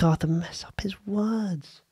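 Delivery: a conspiratorial whisper, slowed down. Real, not acted. Host revealing the producer's prank to listeners, whispered. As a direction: whisper it like a secret.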